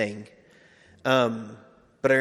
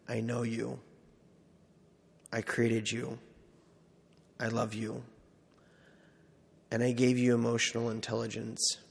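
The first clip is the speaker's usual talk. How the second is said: soft, hushed, loving words; tender voice of God; 5 dB quieter than usual. Retold or imitated. The Lord telling his son who he is, softly and tenderly. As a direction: speak them gently, like a father to his son.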